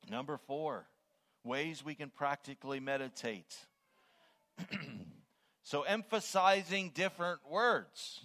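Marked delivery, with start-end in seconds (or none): 5.7-8.2 high, loud, each word hit hard; emphatic preaching. Shown as a demonstration. Preacher introducing a meditation method to the congregation, emphatic. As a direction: punch out the key words, raised and loud. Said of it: "I've been doing that all along".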